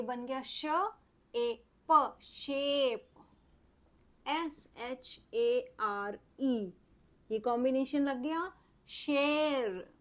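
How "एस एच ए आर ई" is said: एस एच ए पी ई spelled very slowly; slow spelling. Shown as a demonstration. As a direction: drag each letter out for the child. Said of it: "teacher spells letters slowly". (बहुत धीरे अक्षर बोलना)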